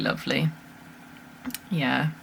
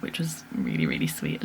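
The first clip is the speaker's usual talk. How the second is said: hushed and touched; 8 dB quieter than usual. Real, not acted. Touched by nan's tears.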